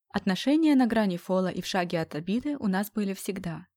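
usual speaking voice

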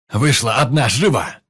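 loud, barked command; shouting order. Acted. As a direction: bellow the order at her. Gunman orders the woman out.

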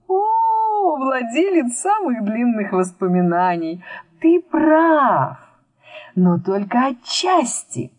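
high, slow, drawn-out flattery and boast; grand old storyteller. Acted. Storyteller figure answers grandly.

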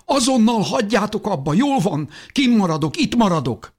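very high, desperate outcry; mother's shout. A narrator's reading. Mother stops the fight by offering to stay, shouting. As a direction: cry out, giving in desperately. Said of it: "kiáltott Mikál édesanyja".

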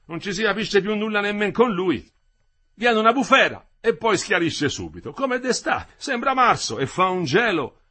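high, loud complaint; grumbling old worker. Acted. Old Renzoni complaining about the weather, grumbling. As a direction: grumble aloud, pitch kept high.

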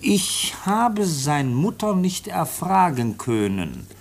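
reading a letter aloud. Acted. Officer reads the foundling's letter to the chief, slow formal reading. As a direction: read out each word slowly and carefully. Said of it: "Ich lese mal vor".

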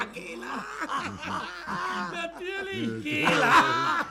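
high squealing laughter through the words; laughing. Acted. Friend howling with laughter at Ratschek's joke punchline. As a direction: shriek the name while laughing.

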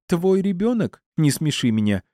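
high-pitched, heated accusation; furious woman's high voice; angry tirade. A narrator's reading. Angry Стефани continues her quarrel.

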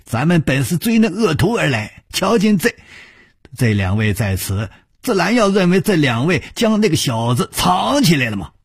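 high-pitched, wheedling self-justification; cowed villain excusing himself. Acted. Bai Yumo explains himself to his chief, high wheedling excuse. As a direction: plead your excuse in a high voice. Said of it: "他咧着嘴说".

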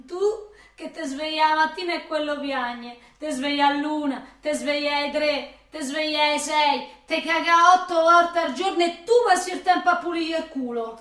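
più forte, incalzante; elenco urlato. Acted. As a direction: gli sbatte in faccia la realtà. Lei gli elenca la fatica, gridando. (lei urla a lui cosa lo aspetta)

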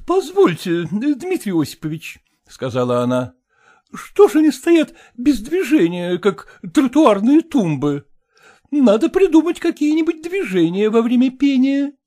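high, brisk society matron; brassy lady voice. A narrator's reading. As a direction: play the bossy choir mistress. Kolkina voiced by reader.

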